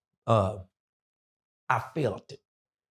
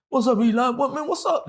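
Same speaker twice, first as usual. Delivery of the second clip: much higher, loud mock calls; everyone calling out; 12 semitones higher, 4 dB louder than usual. The others asking Eli what's wrong, calling loudly. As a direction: shout the questions like a crowd. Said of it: "then they see him acting".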